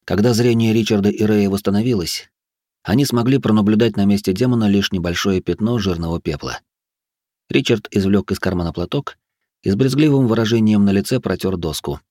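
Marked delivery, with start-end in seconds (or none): none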